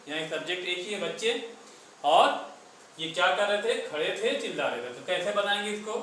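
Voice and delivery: usual speaking voice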